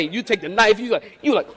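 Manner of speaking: ordinary delivery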